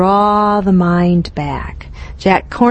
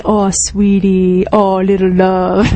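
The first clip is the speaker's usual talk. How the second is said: tender self-talk. Shown as a demonstration. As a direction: soothe yourself like a loved child. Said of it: "speak to myself in those kind ways".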